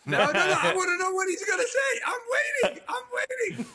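high laughing shout; pleading to hear the story; excited. Real, not acted. Teasing demand to hear.

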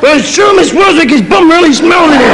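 put-on very high protesting kid; little child's voice. Child in the donkey answering the teacher, high protest. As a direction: squeal it as an indignant small child. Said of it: "this little voice says".

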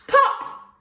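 sudden, explosive high pop; bursting pop. A narrator's reading. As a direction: make it burst like a balloon. Balloon dog bursts.

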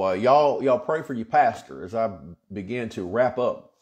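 ordinary talk

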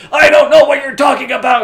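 very high, loud yell; mock panicked shout. Bewildered boy shouting at a stranger, mock panicked shout. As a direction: scream it in hammed-up confusion. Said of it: "He's like".

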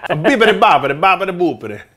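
mock Italian gibberish; hammed-up fake Italian; fake foreign language. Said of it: "Like, that's not Italian".

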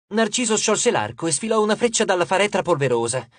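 plain reading voice

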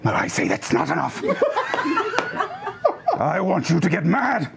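mock-serious rallying cry; rousing speech. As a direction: whip the crowd into anger. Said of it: "you are a very passionate man".

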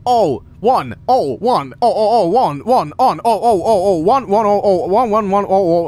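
loud sing-song chant of ones and ohs; reciting binary. Acted. Player mocking having to translate a puzzle into binary, loud rhythmic recital. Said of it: "translate this into, like, uh, binary and be like".

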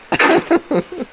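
sharp high loud laugh; high burst of laughter. Real, not acted. Laughing at his own hoarse throat in a high burst.

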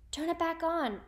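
high, urgent plea; alarmed cry. A narrator's reading. Arnold when the engine stops, alarmed cry. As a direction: cry out with a touch of panic. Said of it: "Arnold cried".

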